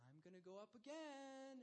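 a hint of a stubborn child; defiant toddler. Daughter defies dad.

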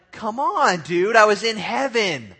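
loud, high mock complaint; exasperated protest. Believer pulled back from heaven, mock exasperated protest. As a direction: whine loudly like you've been cheated.

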